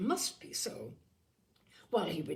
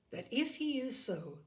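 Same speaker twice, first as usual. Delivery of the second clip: ordinary delivery, nothing marked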